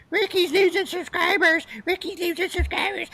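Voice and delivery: high mocking taunt, sing-song; mocking troll voice. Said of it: "I keep hearing the troll say".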